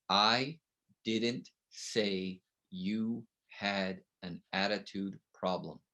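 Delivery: I didn't say you had an attitude problem, said slow and soft; slow, soft demo sentence. Shown as a demonstration. Speaker reading the key sentence to teachers, slow and quiet. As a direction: drop low and slow it out. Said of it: "write down these eight words".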